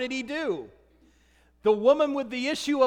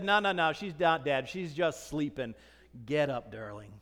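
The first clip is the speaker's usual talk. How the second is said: tender; 9 semitones lower, 5 dB quieter than usual. Acted. Jesus raising the dead girl.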